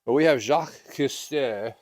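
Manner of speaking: Jacques Cousteau said in a playful French accent; hammy French accent on the name. Shown as a demonstration. Instructor showing the class how to say Cousteau, French accent. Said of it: "you gotta say it with the French accent".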